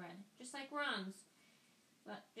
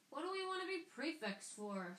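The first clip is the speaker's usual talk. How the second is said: high, disgusted twin voice; revolted; 7 semitones higher than usual. A narrator's reading. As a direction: scoff at the very idea. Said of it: "looking revolted at the very idea".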